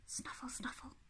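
very soft, voiceless snuffles; snuffling hedgehog. Acted. Little hedgehog roams the garden, hushed snuffling. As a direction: snuffle quietly like a small animal. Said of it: "went the little hedgehog".

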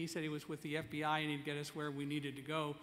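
ordinary talk

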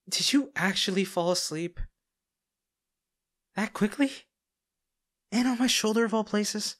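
flustered surprise. Acted. Bodyguard startled as the girl falls asleep on his shoulder. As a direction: react in disbelief as she dozes off on you.

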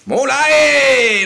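milk it like the reels are climbing; slow hyped-up call; drawn-out hype. Shown as a demonstration. Repeats phrase, then stretches it.

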